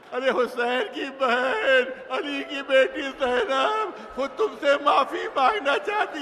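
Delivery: high, loud, impassioned wailing sermon; preaching at full force. Acted. Climax of the sermon story.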